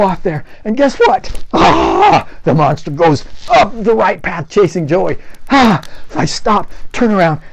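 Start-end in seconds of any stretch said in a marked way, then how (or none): 1.5-2.3 high startled cry; gasp of alarm. A narrator's reading. Horror monster chases Joey. 5.5-5.8 high startled cry; gasp of alarm. A narrator's reading. Horror monster chases Joey.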